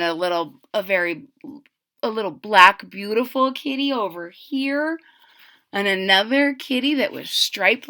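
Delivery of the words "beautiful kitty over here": plain reading voice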